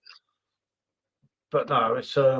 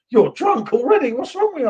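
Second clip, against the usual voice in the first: mocking accusation; 7 semitones higher, 4 dB louder than usual. Mocking quote of drunk accusation.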